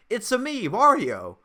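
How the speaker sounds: lightly hammed-up Mario catchphrase, no real accent; mock Chris Pratt. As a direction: start high, then drop onto the name. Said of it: "just Chris Pratt going".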